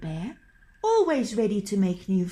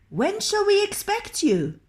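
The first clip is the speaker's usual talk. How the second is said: louder, raised call; 11 semitones higher, 4 dB louder than usual. A narrator's reading. Nils calling to the trolls in the forest. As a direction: call out toward the trees. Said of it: "called Nils".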